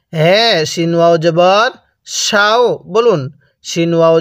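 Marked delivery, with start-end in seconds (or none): none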